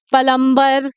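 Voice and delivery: प्लम्बर said "pa-lam-bar", extra vowel after p; wrong split "plumber". Shown as a demonstration. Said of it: "बहुत सारे लोग इसको बोलते हैं".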